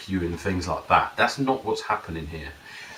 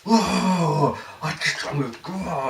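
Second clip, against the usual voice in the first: higher-pitched mock-angry ranting; angry client; 7 semitones higher, slower than usual. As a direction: imitate a client too worked up to finish. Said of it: "really angry, and he goes".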